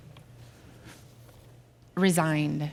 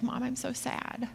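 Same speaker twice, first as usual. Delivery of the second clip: imitating tearful daughter; 7 semitones higher, 7 dB quieter than usual. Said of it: "She just starts to cry".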